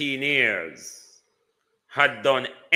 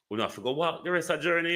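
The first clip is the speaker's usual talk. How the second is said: gabbled out very fast; very fast mocking repeat; rushed repeat; 6 semitones higher, much faster than usual. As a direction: rattle it off in a hurry. Repeated line, plain then rushed.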